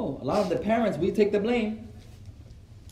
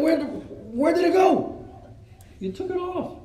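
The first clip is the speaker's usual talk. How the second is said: high, drawn-out bewildered question; mock-panicked, pitched right up; mock alarm; 9 semitones higher, slower than usual. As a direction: act out shocked discovery, high-pitched. Man discovers his loss, acted.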